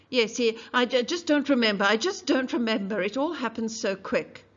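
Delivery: put-on flustered girl rushing her words; stammering witness. Mayella stammering under questioning.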